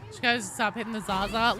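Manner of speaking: usual speaking voice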